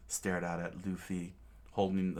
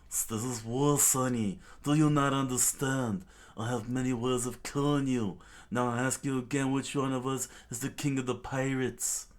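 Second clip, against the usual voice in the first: higher, louder menacing demand; louder villain threat; 5 semitones higher, 5 dB louder than usual. Acted. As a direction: threaten the boy like a pirate tyrant. Don Krieg threatens Luffy.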